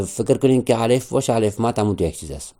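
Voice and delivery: usual speaking voice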